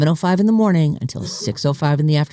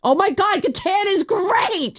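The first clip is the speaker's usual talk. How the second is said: excited; 13 semitones higher, slower than usual. Real, not acted. Gushing over favourite game.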